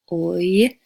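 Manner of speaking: oi gliding from uh to I; oi diphthong. Shown as a demonstration. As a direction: slide oi smoothly. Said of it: "starts with the uh... goes to a I".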